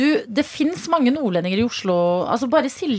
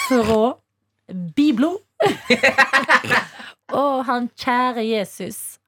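slow, pious psalm reading in Stord dialect; mock devotional in West Norwegian stordamål; gladkristen stordamål; 3 semitones higher, slower than usual. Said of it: "Du blir veldig gladkristen".

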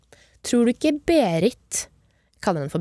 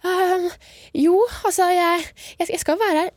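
nervous stammer; 8 semitones higher than usual. Retold or imitated. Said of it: "jeg har jo panikk".

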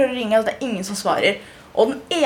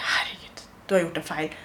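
hushed, breathless whisper to herself; whispered panic; 3 semitones lower, 7 dB quieter than usual. Retold or imitated. Telling herself at the UN she's made a mistake, whispered. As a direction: whisper it in sudden dread. Panic after voting yellow.

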